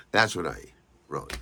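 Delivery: ordinary talk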